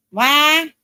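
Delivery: high, loud mock-Southern drawl; exaggerated fake Southern accent. Mocking a woman's fake accent to viewers, exaggerated Southern. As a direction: imitate an overdone Southern twang, loudly. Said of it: "She'll say some words very exaggerated".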